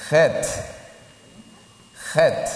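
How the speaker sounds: "Het" with a throaty h from the back; guttural Het. Shown as a demonstration. Teacher drills the hard Hebrew letter Het, throaty. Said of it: "Esa lleva tiempo decirla".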